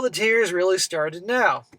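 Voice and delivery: normal talking voice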